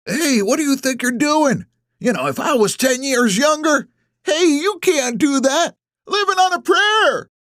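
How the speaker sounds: high-pitched outraged hollering; old-timers yelling. A narrator's reading. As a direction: yell indignantly like old men. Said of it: "The old-timers yell at me".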